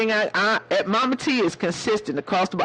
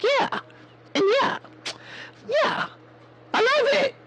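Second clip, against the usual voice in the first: put-on much higher child's yeah yeah; little boy voice. She imitates the little boy, high and childlike. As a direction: pitch up into a small boy's voice. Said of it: "He was like".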